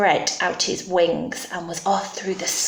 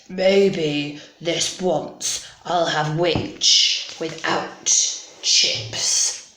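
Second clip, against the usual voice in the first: low, slow, relishing dragon voice; hungry dragon; 3 semitones lower, much slower than usual. Acted. Dragon closes in on the witch, low slow hungry voice. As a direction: savour each word like a meal. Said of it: "licking his lips, said".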